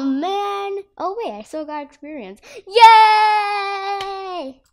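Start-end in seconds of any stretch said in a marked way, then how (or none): 2.7-4.6 excited yay. Real, not acted. Gained experience.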